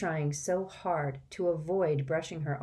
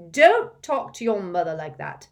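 loud, firm fatherly rebuke; 4 semitones higher, 10 dB louder than usual. Acted. Mr Weasley rebukes Ron firmly and loudly. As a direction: lay down the law. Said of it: "said Mister Weasley firmly".

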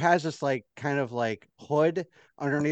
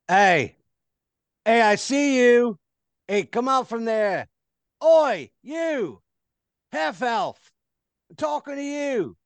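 high, loud guard yelling at her; guard shouting; 10 semitones higher, 6 dB louder, slower than usual. Acted. Guard yells at the hiding half elf, loud and high. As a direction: call her out, sharp and loud.

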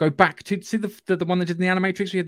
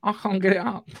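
ordinary talk

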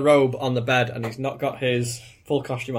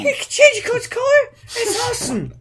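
very high, loud squeal of amazement; giddy high-pitched shout; awestruck excitement; 7 dB louder than usual. Acted. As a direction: squeal like a thrilled fan. Amazed at colour-changing coat.

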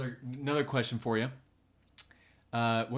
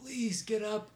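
pleading; 10 semitones higher, slower than usual. Retold or imitated. Begging herself to get up.

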